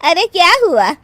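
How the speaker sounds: loud, high startled questions; affronted disbelief; taken-aback challenge. Acted. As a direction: ask sharply why she is laughing. Puzzled guest challenges the laughter.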